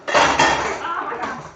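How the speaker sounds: slow, loud oh my God; loud drawn-out gasp. Real, not acted. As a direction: exclaim in shock. Sudden exclamation.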